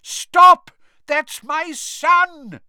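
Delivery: high, loud, drawn-out shout; desperate yell. A narrator's reading. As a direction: scream to protect your child. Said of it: "yelled a voice he recognized".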